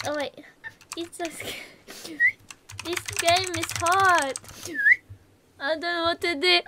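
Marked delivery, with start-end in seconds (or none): 1.0-2.2 slow, hushed, whispered fear; scared whisper. Real, not acted. Streamer spooked by the game, scared whisper. Frightened player, voice drops away.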